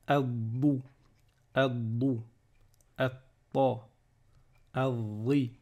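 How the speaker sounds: "adou", "atta", "adri" with the consonant held double; doubled consonant. Shown as a demonstration. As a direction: silent lam, lean on the d and t in "adou", "atta". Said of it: "on va doubler la lettre d'après".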